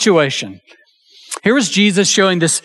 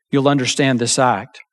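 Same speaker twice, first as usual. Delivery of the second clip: ordinary delivery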